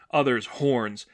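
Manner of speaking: ordinary delivery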